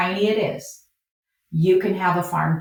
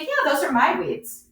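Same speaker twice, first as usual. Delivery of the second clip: playful, higher-pitched owning up; joking comeback; 7 semitones higher than usual. Acted. Farmer answering a tour guest's criticism, jokingly. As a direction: toss it off as a light joke. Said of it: "I always just kinda make a joke".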